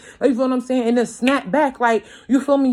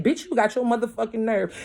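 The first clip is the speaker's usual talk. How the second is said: usual speaking voice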